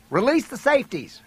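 high-pitched urgent command; urgent instruction. A narrator's reading. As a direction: call out the order under fire. Shouting gun instructions under fire.

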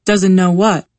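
a touch of sudden alarm; mildly alarmed, quick to question; instantly alarmed. A narrator's reading. Boyfriend reacts to doctor's hint of a secret, mildly alarmed. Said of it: "instantly alarmed".

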